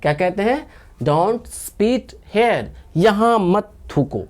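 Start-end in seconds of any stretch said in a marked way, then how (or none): 1.0-2.6 slow, each word set apart; slow drill. Shown as a demonstration. Teacher repeating the translation for learners, slow drill. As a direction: model it slowly for repeating.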